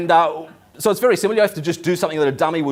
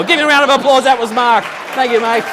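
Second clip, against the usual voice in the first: much higher and louder, like a showman; hyped-up applause call to the crowd; crowd-rousing call; 8 semitones higher, 6 dB louder than usual. Real, not acted. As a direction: rouse the audience to applaud. Host whips up applause.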